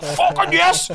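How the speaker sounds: very high, loud laughing cheer; gleeful yell at the movie; triumphant cheer. Retold or imitated. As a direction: cheer like you just won. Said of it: "I just watched Vin Diesel like".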